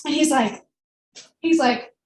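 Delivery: ordinary talk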